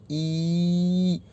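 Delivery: ई as a long, held ee vowel. Shown as a demonstration. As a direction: hold ई out long and steady. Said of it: "बड़ी, जो longer है". (लंबी खिंची हुई बड़ी ई)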